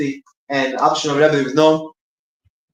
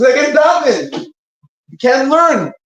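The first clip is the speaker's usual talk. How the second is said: high-pitched, drawn-out mock wail; retold crying lament; 11 semitones higher, 4 dB louder, slower than usual. Prisoner explains to his brother why he's crying, plaintive. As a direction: imitate a distressed prisoner, voice pitched way up. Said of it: "One of them was crying".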